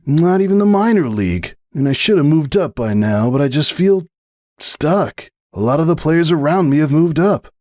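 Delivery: lightly voiced young athlete, fed up; a hint of a stuck young player grumbling; young stallion, frustrated. Young stallion complaining to his marefriend about his career.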